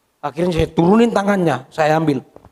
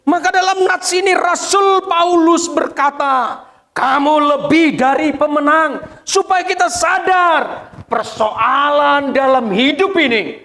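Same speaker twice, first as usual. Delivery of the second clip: high, slow, ringing proclamation; full-force preaching; 10 semitones higher, 5 dB louder, slower than usual. Real, not acted. Pastor proclaiming Paul's words to congregation, high slow preaching. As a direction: declare the verse slowly at full voice.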